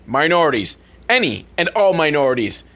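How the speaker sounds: usual speaking voice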